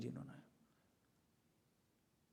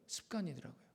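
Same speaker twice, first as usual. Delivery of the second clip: soft repeated habit; 10 dB quieter than usual. Shown as a demonstration. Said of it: "이게 습관이더라고요".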